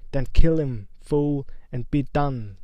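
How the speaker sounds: a loud, furious screech. Acted. Voldemort commanding Quirrell in a loud screech. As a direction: scream the order loud. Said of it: "screeched Voldemort".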